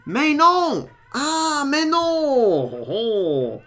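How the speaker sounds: high, loud hammy French mais non; mock French accent. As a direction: overdo a French exclamation. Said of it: "doing this French appropriation thing".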